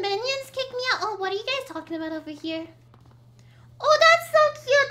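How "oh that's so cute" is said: gushing. Real, not acted. Gushing at cute art.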